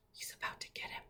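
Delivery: hushed, tense whisper; suspense whisper. Real, not acted. Teacher whispering to the class as the wolf closes in. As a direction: build dread. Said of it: "suspense whisper to class".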